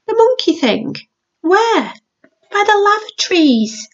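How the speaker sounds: put-on a young boy piping up his answers; little boy Max.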